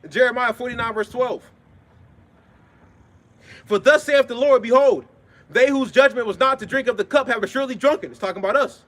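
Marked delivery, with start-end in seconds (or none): 3.6-8.1 loud, high declamatory Bible reading; forceful scripture proclamation. Acted. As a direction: proclaim the verse in a raised, ringing voice. Reads scripture aloud.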